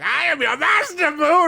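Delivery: hammed-up high wizard; mock Babidi. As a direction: command him like a tiny tyrant. Babidi voiced in a retelling.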